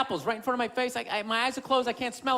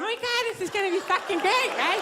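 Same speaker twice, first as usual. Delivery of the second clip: put-on excited girlfriend; 6 semitones higher than usual. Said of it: "She's, like, swinging my arm".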